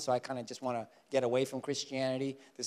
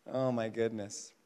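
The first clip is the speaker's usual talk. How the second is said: ordinary talk, nothing marked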